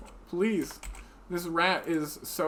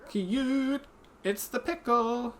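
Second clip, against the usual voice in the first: cutesy squeal; 6 semitones higher, slower than usual. Acted. Voicing cute delight at a creature.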